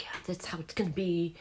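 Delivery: breathy whisper, grudging; whispered resentment. Retold or imitated. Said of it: "I get mad, and I'm like".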